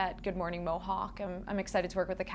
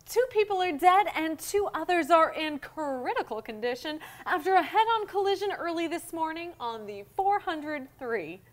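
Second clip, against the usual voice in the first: over-the-top news read; 8 semitones higher, 4 dB louder than usual. Acted. Comic news audition read.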